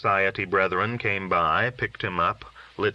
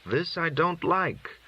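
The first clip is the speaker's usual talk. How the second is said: high and slow, uneasy dread; frightened; 9 semitones higher, slower than usual. Acted. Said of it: "now frightened".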